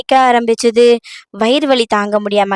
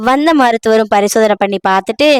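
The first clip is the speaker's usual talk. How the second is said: ordinary delivery, nothing marked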